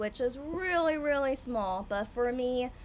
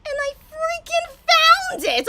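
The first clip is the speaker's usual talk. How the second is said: much higher and louder, slow triumphant cry; 13 dB louder, slower than usual. Real, not acted. Streamer telling viewers she found the beer, triumphant and loud. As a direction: belt it out like you won a prize.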